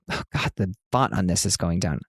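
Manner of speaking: normal talking voice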